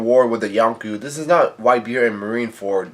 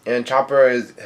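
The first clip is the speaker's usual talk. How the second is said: ordinary delivery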